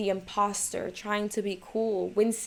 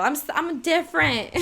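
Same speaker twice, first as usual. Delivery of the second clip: higher, giggly mock pick-me; giggly pick-me girl; 8 semitones higher, 4 dB louder than usual. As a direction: tease yourself as a pick-me girl. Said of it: "I sound like a pick-me girl".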